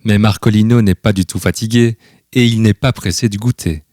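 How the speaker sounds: plain reading voice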